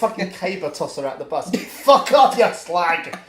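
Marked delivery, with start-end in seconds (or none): 1.6-3.2 high, very loud hammed-up abuse; mock furious shout. Throwing a passenger off the bus, mock furious shout. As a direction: bellow it as a joke send-off.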